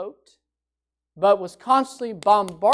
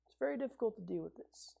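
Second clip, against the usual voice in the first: much softer and faster, tossed off; a quick soft aside; weary murmur; 17 dB quieter, faster than usual. Retold or imitated. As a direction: mutter it under your breath. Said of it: "We say".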